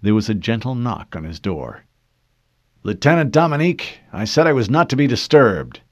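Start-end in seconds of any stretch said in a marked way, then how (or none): 2.8-5.8 loud, sharp rebuke; irritated shout. Acted. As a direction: snap at the intruder, raising the voice. Keyes snaps at the knock.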